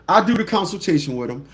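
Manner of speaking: ordinary talk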